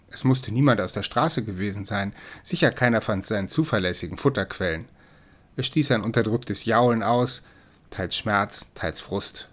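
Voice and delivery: ordinary delivery, nothing marked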